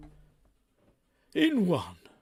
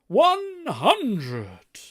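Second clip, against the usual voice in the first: high, loud darts referee call; darts caller shout; 10 semitones higher, 8 dB louder than usual. Pretend. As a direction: bellow it like a darts MC. Darts caller announces a score.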